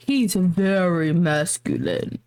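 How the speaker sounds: low, slow imitation of a macho voice; mocking his deep masculine tone. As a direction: drop the voice low and drag it out. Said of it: "You said, you said".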